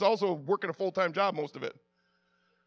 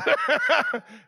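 hearty laugh; 6 semitones higher, 4 dB louder than usual. Real, not acted. Both burst out laughing.

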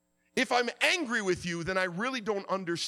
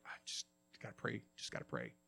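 muttered low and soft, far away; a worn-out man mumbling his prayer under his breath; mumbled to himself; 12 semitones lower, 17 dB quieter, faster than usual. Retold or imitated. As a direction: murmur it to yourself like a weary prayer. Retelling his own prayerful brooding.

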